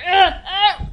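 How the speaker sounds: high, loud straining grunts; effortful grunts. Acted. Greg wrenches his arm free, grunting with effort.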